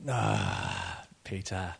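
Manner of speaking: soft, low, indulgent "Ah, Peter"; patient teacher gently shaking his head; a hint of fond patience; patient sigh. Jesus's patient reply.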